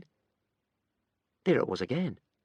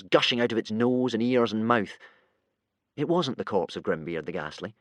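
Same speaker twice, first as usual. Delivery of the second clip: plain reading voice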